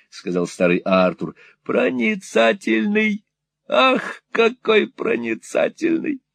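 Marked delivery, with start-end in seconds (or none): 1.6-6.2 put-on high, wheedling old miser voice; fawning old moneylender. Old usurer fawning on Ralph, high and wheedling. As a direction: simper it like a cringing usurer. Gride voiced in Nickleby reading.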